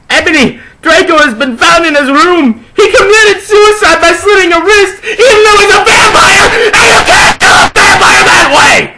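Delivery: high, loud hammed-up crying shout; mock hysterical bawling; wailing announcement. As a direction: sob out the terrible news. Headmaster announces death, wailing.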